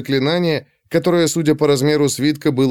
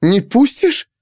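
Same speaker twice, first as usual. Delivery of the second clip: high-pitched incredulous echo; stunned disbelief; 10 semitones higher, 3 dB louder, slower than usual. A narrator's reading. Girl challenging the servant who blocks her, stunned. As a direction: throw it back, pitch shooting up. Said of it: "опешила девушка".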